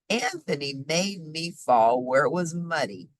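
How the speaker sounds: plain reading voice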